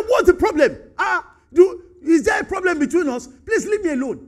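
loud, high, irritated pushback; rude dismissal. Retold or imitated. Cain telling God to leave him alone, loud and annoyed. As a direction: wave God off rudely. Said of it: "Is that what he was telling God".

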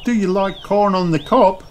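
high-pitched friendly hostess voice; Missus Kangaroo. A narrator's reading. Missus Kangaroo offers lunch to guests, high-voiced. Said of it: "asks Missus Kangaroo".

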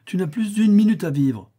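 high, loud indignant threat. A narrator's reading. Death warning the impious old man, indignant. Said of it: "reprit la mort indignée de son impiété".

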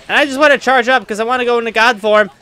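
ordinary talk

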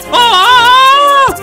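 long, piercing truck shriek; high scream. A narrator's reading. Trucks scream as they crash.

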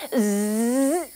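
buzzy zip noise; zipper sound. Acted. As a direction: buzz like a tent zip. Zipping up the tent.

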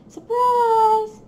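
high singsong "surprise!"; cheery surprise. Real, not acted. Showing viewers the six placer, a cheery reveal. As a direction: sing it out like a reveal.

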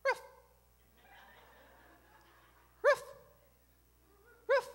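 short, high little dog yap; imitated chihuahua bark. Man mimicking his chihuahua at night, short yappy barks. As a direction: yap "ruff" like a small dog. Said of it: "The chihuahua barks as soon as".

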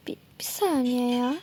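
soft unvoiced whisper; a hushed whisper while searching. Real, not acted. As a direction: whisper it as you notice something. Noticing something.

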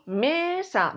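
"mesa" with a long, strong ME; MÉÉ-sa, slow; stress on first syllable. Shown as a demonstration. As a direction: hold ME in "mesa". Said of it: "La más fuerte".

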